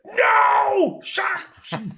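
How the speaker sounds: very high anguished wail; overheard scream. Retold or imitated. Wife finding cat mess the next morning, high mock scream. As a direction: imitate a horrified cry from another room. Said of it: "and I hear".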